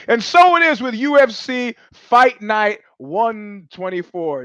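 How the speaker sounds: high, drawn-out fight-show intro; show announcer. Real, not acted. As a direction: stretch it out like a grand introduction.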